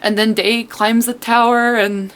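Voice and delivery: ordinary talk, nothing marked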